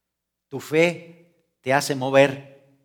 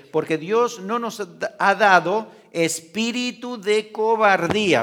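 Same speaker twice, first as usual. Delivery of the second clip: slow, emphatic reading of the verse, rising and louder on "cobardía"; scripture read aloud; 5 semitones higher, 4 dB louder, slower than usual. Shown as a demonstration. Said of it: "segunda Timoteo uno siete dice". (lectura lenta y enfática del versículo)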